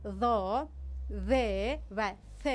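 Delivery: "ذا، ذ" and "ث" with the tongue tip between the teeth; th sounds, dark in "ذا", light in "ذ" and "ث"; tongue pushed forward. Shown as a demonstration. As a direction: let the tongue peek out for "ذا، ذ و ث".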